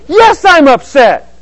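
loud, high, stretched-out retort; hammed-up wail of a wronged partner; upset spouse. Spouse answering partner's question, loudly exasperated.

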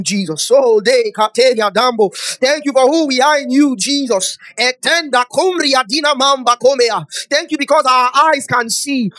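loud, high-pitched praying in tongues and thanks; fervent prayer. Real, not acted. Preacher thanking Jesus and speaking in tongues, fervent loud prayer. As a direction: pray at full force, chanting each phrase.

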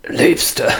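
rattling, gasping croak; death rattle. Acted. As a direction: call out with a dying rattle. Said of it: "ertönt wieder röchelnd".

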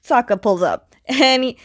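ordinary talk, nothing marked

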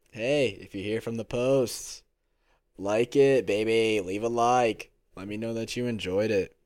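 loud, punchy shout-out for likes; audio spike. Real, not acted. Podcaster urging viewers to leave a like, loud audio spike. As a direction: belt out the plug so it spikes the mic. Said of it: "that audio spike is stupid".

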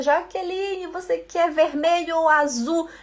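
a hint of an eager friend; friend asking. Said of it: "A Bruna me faz uma pergunta".

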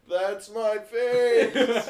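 high, very slow mock shriek; hammed-up imitation of a friend's scream; imitated scream. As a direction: replay the yell for laughs. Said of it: "I just hear Tanner".

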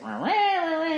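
high whiny mock wail of rah rah; hammed-up high-pitched whining of an upset opponent. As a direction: squeal the rahs like a sore loser. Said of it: "bitching and wailing".

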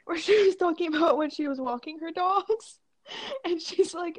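ordinary talk, nothing marked

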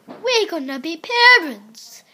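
loud, happy surprise; surprised. Acted. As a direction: burst out with the news. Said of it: "he said with surprise".

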